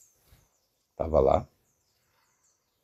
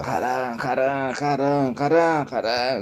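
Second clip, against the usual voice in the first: rapid repeated cry, softly imitated; understated cry of agony, rising then dropping; mock agonized cry; 4 dB quieter, slower than usual. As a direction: imitate a gored man crying out, half-voiced. Gored man starts crying out Haram.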